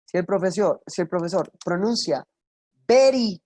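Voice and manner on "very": "very" with a B sound and Spanish tap, called a bad mistake; mock the wrong "very" loudly; "very" said like "beri", loud, high; wrong pronunciation. Shown as a demonstration. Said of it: "está very grave".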